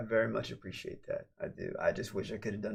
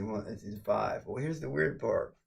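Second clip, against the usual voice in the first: ordinary talk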